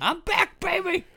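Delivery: high, loud hammed-up stage entrance; mock comeback cry. Joking as Bill Cosby back on stage, hammed-up cry. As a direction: crow it like a returning star, mockingly.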